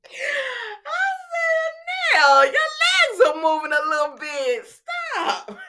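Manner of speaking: very high, squealing through laughter; cracking up. Real, not acted. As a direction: shout it helplessly amused, voice shooting up. Cracking up at her own joke.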